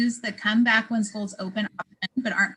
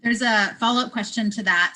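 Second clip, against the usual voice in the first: ordinary talk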